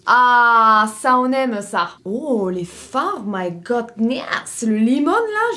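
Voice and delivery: très lent, voix admirative; émerveillée. Real, not acted. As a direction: savourer chaque mot, ébahie. Émerveillée devant les fards. (émerveillement ralenti)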